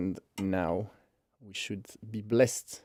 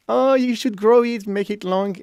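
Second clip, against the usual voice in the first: high, louder pleading quote; raised-pitch impression of an eager daughter; imitated daughter; 9 semitones higher, 7 dB louder than usual. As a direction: mimic a daughter urging her dad. Voices daughter's pleading in story.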